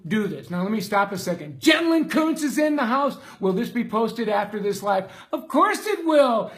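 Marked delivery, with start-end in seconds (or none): 1.6-3.2 excited greeting. Real, not acted. Greeting a viewer on live stream. 5.3-6.5 higher, louder, certain reply; emphatic yes. Real, not acted. Answering a viewer's question.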